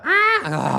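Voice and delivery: sudden high, loud yelp; short baby-like squawk out of silence; imitated baby cry. Comedian showing parents the baby sound, high sudden cry.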